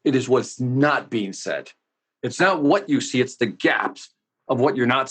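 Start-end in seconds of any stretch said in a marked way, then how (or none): 0.0-1.7 "It is" said slowly, each word weighted; slow and deliberate, leaning on "It is"; stress on "It is". Shown as a demonstration. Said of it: "put an emphasis on that".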